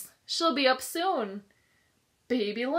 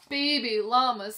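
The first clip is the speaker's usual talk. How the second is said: usual speaking voice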